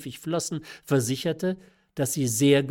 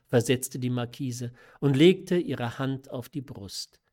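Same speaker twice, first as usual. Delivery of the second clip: ordinary delivery, nothing marked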